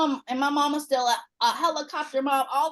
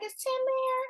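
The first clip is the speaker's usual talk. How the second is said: high lilting mock mom; mom's sing-song question; 11 semitones higher, 6 dB quieter than usual. Mom asking for the boyfriend, high-pitched. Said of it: "she's like".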